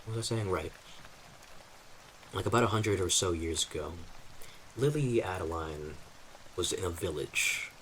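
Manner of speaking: ordinary talk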